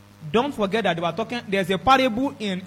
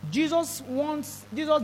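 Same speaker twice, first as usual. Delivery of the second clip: slow, drawn-out emphasis; 7 semitones higher, much slower than usual. Real, not acted. Preacher stretches out the words.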